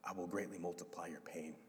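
a grieving father breaking bad news; heartbroken. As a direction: let the words ache.

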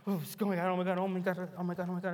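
mock frightened old woman; panicked grandmother. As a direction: imitate a terrified grandma praying fast.

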